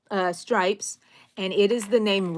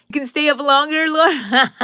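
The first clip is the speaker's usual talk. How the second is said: playful call; 7 semitones higher, 11 dB louder than usual. Real, not acted. Chatting to a viewer live.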